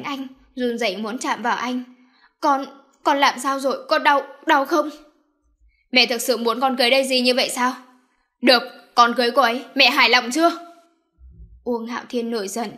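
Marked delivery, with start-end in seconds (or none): 2.4-4.9 higher, louder, shaken worry; anxious concern. Acted. Mother anxiously checking the son she just slapped. 5.9-10.5 loud, fast, bitter outburst; bitter roar. Acted. Son shouting bitterly at his mother before storming off. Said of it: "gầm lên một tiếng".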